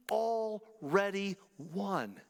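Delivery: "already won" drawn out very slowly; preacher lands "already won" slowly; stress on already. Real, not acted. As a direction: linger on "already". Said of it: "preacher stressing already".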